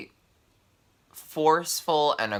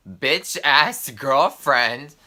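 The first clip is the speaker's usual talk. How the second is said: slow, drawn-out incredulous question, louder; offended echo; 2 semitones higher, 6 dB louder, much slower than usual. Retold or imitated. Friend throws the insult back at the teller, offended and incredulous. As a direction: repeat the insult back in mock outrage.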